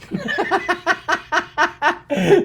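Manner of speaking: high-pitched burst of laughter; rapid high-pitched chuckling; hearty laugh. Real, not acted. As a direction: let out a long, high laugh. Laughs at own remark about wife.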